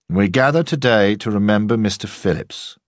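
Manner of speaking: a bit of a ham at the lectern; commanding orator. A narrator's reading. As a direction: deliver it like a headmaster addressing a packed chapel. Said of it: "Waugh was a commanding speaker".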